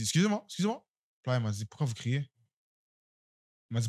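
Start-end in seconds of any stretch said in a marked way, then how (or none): 0.4-0.8 high, rising call without extra volume; retold calling-out. Clerk calling to a departing customer, high mock call. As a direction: re-enact calling after someone. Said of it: "mais j'ai crié".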